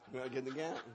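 chuckling through the words; laughing repeat. Retold or imitated. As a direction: repeat "I gotta get the cat" while laughing.

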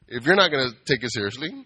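ordinary talk

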